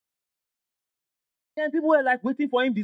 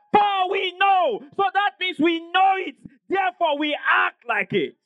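high, drawn-out proclamation; exultant preaching shout; 7 semitones higher, slower than usual. Real, not acted. Preacher proclaiming scripture to congregation, exultant shout. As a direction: cry it out with full conviction.

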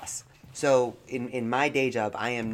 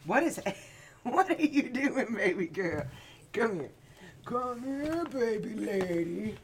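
talking to a cat; 8 semitones higher than usual. Real, not acted. Cooing to the cat.

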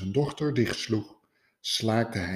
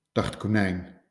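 usual speaking voice

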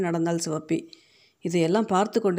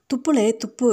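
put-on higher, urgent command to a child; sharp scolding; 5 semitones higher, 4 dB louder than usual. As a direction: order him crossly to spit it out. Mother orders child to spit.

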